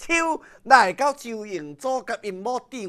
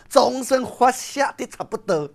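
usual speaking voice

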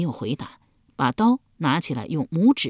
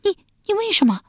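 much higher shocked outcry; wife crying out at the plan to kill their son; alarmed cry; 4 dB louder, faster than usual. A narrator's reading. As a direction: cry out in horror. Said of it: "亚子惊叫一声道".

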